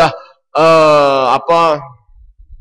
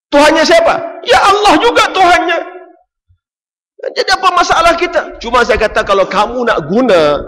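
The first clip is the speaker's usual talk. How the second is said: much higher, louder rhetorical cry; full-force preaching; 14 semitones higher, 4 dB louder than usual. Real, not acted. Preacher rousing his audience, loud and high. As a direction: hurl the questions at the crowd.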